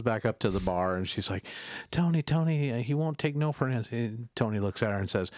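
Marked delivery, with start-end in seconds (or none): 1.9-3.9 raised urgent voice; hint of a frantic waitress. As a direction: plead with the bartender. Waitress pleading to bartender.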